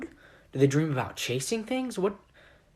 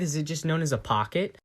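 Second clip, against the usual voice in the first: ordinary talk, nothing marked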